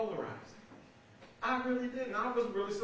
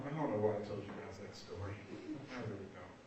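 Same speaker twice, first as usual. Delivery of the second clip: ordinary talk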